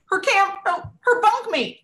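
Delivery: high, loud burst of amazement; amazed exclamation. Real, not acted. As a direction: squeal it, overcome by the story. Amazed at camper's best friend.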